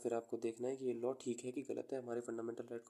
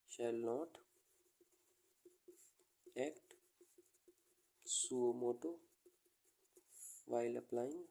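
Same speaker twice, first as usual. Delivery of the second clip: word by word with long pauses; slow phrases, waiting between each; dictating while writing; much slower than usual. Real, not acted. Teacher writing out the third rule for students, slow dictation.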